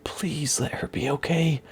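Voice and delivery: slow, very soft desperate plea; fading anxious murmur. Acted. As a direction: let the prayer sink to almost nothing. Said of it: "Silas kept repeating to himself".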